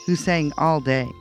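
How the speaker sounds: ordinary delivery, nothing marked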